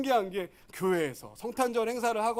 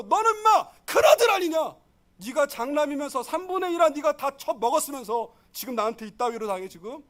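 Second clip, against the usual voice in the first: loud, high angry scolding, fading at the end; father shouting at his son; 6 semitones higher, 6 dB louder than usual. Retold or imitated. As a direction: berate the rude elder son. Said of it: "이래도 무방합니다".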